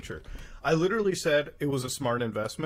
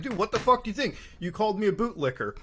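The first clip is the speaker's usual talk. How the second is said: higher-pitched indignant challenge; retold anger; 6 semitones higher than usual. Replaying his angry retweet after being insulted, retold anger. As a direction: push back, affronted, without shouting. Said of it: "I got, I got angry".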